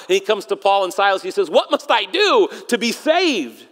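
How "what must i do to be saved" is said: acted desperate plea, drawn out; mildly frantic cry for help; freaking out. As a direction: plead slowly as a panicked jailer. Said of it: "the jailer's freaking out".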